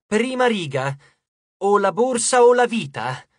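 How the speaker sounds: emphatic message reading; reading aloud. A narrator's reading. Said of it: "Lei lesse ad alta voce il messaggio".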